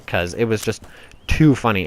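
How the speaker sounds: ordinary talk, nothing marked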